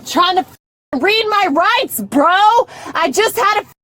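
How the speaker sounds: high, heated outburst; real indignant yelling; angry protest. Real, not acted. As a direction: argue back at full agitation. Furious protest at the officer.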